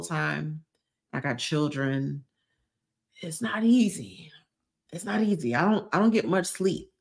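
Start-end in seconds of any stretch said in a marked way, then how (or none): 3.2-4.3 breathy whisper, leaning in; whispered aside. Real, not acted. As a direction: let it slip out as a whisper. Repeats it as confession.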